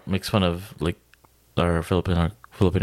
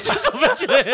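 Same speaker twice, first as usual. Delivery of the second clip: higher, pleading while laughing; 12 semitones higher than usual. Real, not acted. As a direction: beg through the giggles.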